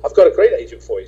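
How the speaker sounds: high, loud eager voice; eager girl. Retold or imitated. The print shop girl pushes her agent on him, high and loud. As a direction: push it on him with eager glee. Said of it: "She says".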